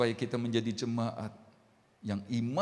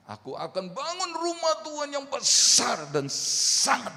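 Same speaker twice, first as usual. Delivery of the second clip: grand promise; 13 semitones higher, 8 dB louder than usual. Acted. David's grand promise to Nathan.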